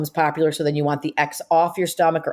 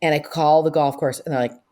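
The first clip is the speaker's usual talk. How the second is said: ordinary delivery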